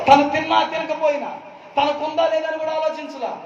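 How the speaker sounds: high, fervent preacher's cry to the church; impassioned preaching. Acted. Preacher extolling the widow to the congregation, impassioned and high. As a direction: lift it into full-force preaching. Preacher rising to full force.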